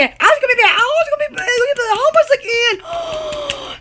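theatrical gasp of horror; hammed-up panic. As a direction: play up mock dread. Dramatic hurricane panic.

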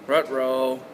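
low, mushy cartoon dog "Rut row". Teacher to pupils, mock cartoon-dog dismay. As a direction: play a goofy cartoon dog in trouble. Teacher clowning over untied shoe.

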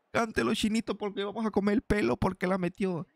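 high commentator crowing; excited celebration. Real, not acted. Gamer celebrating his scorer, excited high-pitched crowing. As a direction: keep crowing high as the goal frenzy settles.

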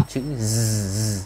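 "Z" held as a long buzz; voiced Z sound. Shown as a demonstration. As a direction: draw "Z" out with the voice on. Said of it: "chúng ta sẽ phát âm thành".

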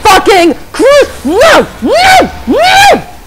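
high yells building to screams; furious screaming. Real, not acted. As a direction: lose it completely at the lag. Screams at lagging game.

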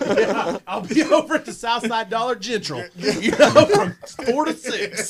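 mock announcement. Mocking wannabe's autograph signing.

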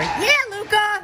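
high, loud, drawn-out cheer. Real, not acted. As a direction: stretch out the cheer for him. Cheering on a player.

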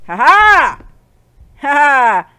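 loud, high gloating shout; triumphant crowing. Real, not acted. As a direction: crow with big triumphant laughs. Gamer crows over success.

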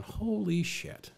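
hushed, drawn-out amazement; stunned disbelief. Retold or imitated. Newcomer in his chair, hushed drawn-out astonishment. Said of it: "sitting there in my chair going".

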